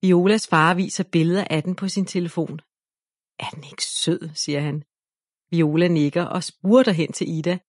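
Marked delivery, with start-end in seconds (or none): 3.4-4.3 soft unvoiced whisper. Father showing Viola the cub, soft whisper. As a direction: whisper it tenderly over the photo.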